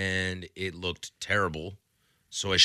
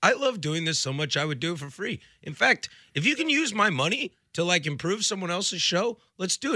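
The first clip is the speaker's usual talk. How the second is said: eager volunteer; 8 semitones higher than usual. Retold or imitated. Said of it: "I was like".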